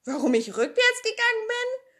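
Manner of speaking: put-on high, bright-eyed girl's voice tossing the question back; Pippi echoing. Pippi echoes Tommy's question.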